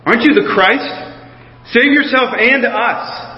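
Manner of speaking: a touch of sneer, slowing on the last words; lightly voiced jeering criminal; mild mocking insult. A narrator's reading. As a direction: read the taunt with a hint of scorn. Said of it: "hurled insults at him".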